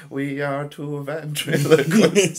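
mock a silly dub, drawn out; imitating a daft dubbed line, laughing; bad dub voice. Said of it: "someone's just talking".

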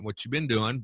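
ordinary talk, nothing marked

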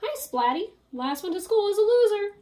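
high, loud, quick taunting bully cat; teasing grin. Acted. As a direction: race him with a cocky grin. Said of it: "said Spike with a grin".

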